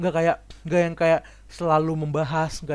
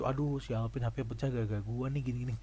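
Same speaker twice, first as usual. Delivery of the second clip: guilty mumble; 5 semitones lower, 8 dB quieter than usual. Retold or imitated. Friend's guilty inner voice.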